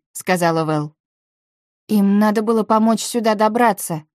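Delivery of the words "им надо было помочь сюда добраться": usual speaking voice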